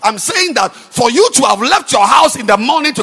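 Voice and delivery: loud, ringing pulpit delivery; full-force preaching. Acted. Preacher driving home to the congregation, loud and ringing. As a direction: preach it out to the back rows.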